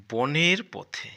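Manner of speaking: slow, loud, drawn-out title read; reading aloud. Shown as a demonstration. Teacher reading the lesson title to pupils, slow and loud. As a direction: announce the title slowly to the class.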